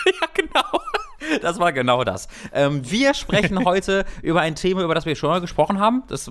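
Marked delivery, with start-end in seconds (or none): 0.0-0.8 laughing through the words. Real, not acted. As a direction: say it while cracking up. Laughing at a joke.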